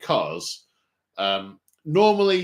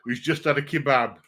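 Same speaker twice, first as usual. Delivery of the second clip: faux Yorkshire accent; 3 semitones higher, 5 dB louder than usual. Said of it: "saying kebab in a faux Yorkshire accent".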